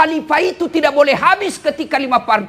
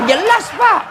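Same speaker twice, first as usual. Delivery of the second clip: mock eager agreement; 7 semitones higher, slower than usual. Voicing approving listener.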